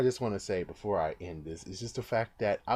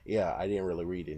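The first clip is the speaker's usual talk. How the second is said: normal talking voice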